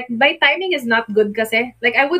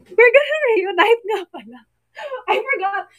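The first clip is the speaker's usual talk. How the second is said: teary; 9 semitones higher than usual. Real, not acted. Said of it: "Tawa after iyak".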